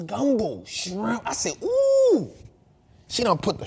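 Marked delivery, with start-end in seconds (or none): 1.6-2.3 high, loud, falsetto ooh; delighted ooh. Retold or imitated. Dad recalling the gumbo smell, a high delighted ooh. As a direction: squeal it like the smell just hit you. Reacting to shrimp in pot.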